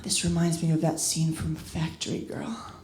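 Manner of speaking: low, hushed pillow-talk voice; mock whisper. Boyfriend close to her ear mid-lovemaking, mock whisper. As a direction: murmur it softly, imitating a lover. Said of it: "he whispers".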